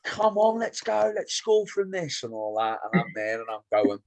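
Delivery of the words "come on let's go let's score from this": very high, put-on supporter voice; high-pitched mock encouragement, slowing at the end; mock fan cheering. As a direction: mimic a fan urging the team on. Mimicking a rival fan cheering.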